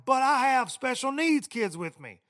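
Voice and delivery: mock yell. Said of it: "And he's like".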